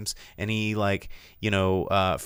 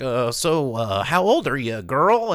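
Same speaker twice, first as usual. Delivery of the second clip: hesitant creep, rising on the last word; creepo perv; 4 semitones higher, 5 dB louder than usual. Acted. Undercover cop posing as creep in a sting, mock pervy come-on. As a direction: play a creepy come-on with stalling uhs. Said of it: "pretending to be, like, this creepo perv".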